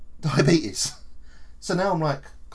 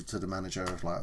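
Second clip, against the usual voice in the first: ordinary delivery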